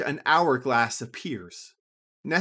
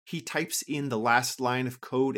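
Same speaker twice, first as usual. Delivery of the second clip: plain reading voice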